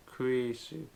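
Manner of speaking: Kurisu said slowly, syllable by syllable. Shown as a demonstration. Teacher repeating a name for learners, slowly. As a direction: draw out the sounds of Kurisu. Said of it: "slowly like this".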